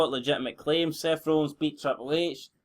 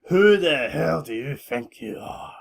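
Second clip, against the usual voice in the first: imitating McMahon; 3 dB louder than usual. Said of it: "McMahon replies".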